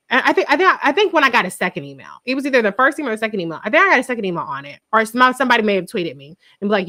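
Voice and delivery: usual speaking voice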